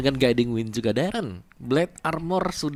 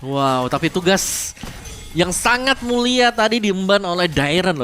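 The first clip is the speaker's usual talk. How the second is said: excited; 8 semitones higher, 7 dB louder, slower than usual. Real, not acted. Cohost hyping teammate's play.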